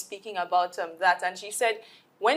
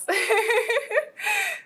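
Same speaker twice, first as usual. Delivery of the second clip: high giggling laugh. Real, not acted. Host laughing as she hands over to the next guest, high laugh. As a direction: laugh lightly, high in pitch.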